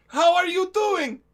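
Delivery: high, loud, slow sly alien greeting; Nash's Chiss voice. Retold or imitated. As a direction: stretch it out high and loud like a smug blue alien. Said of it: "just Nash's Chiss going".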